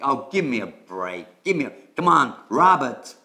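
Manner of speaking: light American accent, a touch exasperated. Shown as a demonstration. Teacher demonstrating the American phrase, light American accent. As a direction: give me a break, American style, lightly done. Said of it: "do it with a good American accent".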